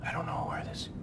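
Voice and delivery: hushed whisper. Real, not acted. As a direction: whisper while sneaking. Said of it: "We're still whispering".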